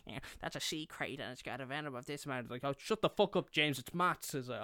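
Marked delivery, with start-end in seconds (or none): 0.1-2.5 quick, rattled-off nerd facts in a put-on voice; mock your younger nerdy self; nerdy know-it-all. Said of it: "I was such a fucking nerd". 2.6-4.3 louder, higher mock outburst; irritated interruption. Classmate snapping at nerdy kid.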